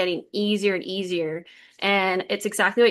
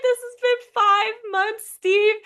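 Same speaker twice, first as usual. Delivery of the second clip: much higher, strained, tearful retelling; tearful outburst; 11 semitones higher, slower than usual. Retold or imitated. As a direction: let the relief spill out as half-crying. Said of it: "And I started crying".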